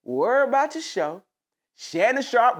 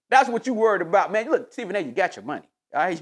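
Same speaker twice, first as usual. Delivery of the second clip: usual speaking voice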